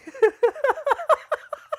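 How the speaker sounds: a loud, high burst of laughter; real laugh at the quote. Real, not acted.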